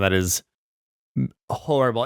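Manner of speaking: ordinary delivery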